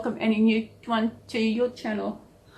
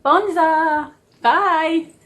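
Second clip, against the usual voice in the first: higher, louder sing-song goodbye; cheery farewell; 7 semitones higher, 5 dB louder, much slower than usual. Real, not acted. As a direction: wave them off brightly.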